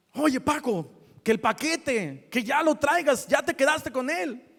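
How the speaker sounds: high, insistent phone telling-off; scolding. Retold or imitated. Post office lady scolding the courier over the package, exasperated. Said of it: "lo empezó a regañar".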